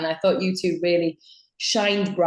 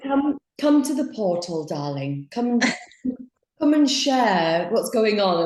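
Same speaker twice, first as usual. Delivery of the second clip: imitating her mom; 3 semitones higher than usual. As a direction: coax like a spiritual mother calling you in. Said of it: "My energetic mom".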